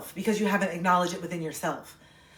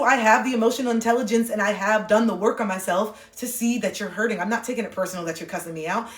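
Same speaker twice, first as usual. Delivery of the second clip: ordinary delivery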